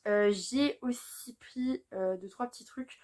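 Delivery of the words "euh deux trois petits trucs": ordinary delivery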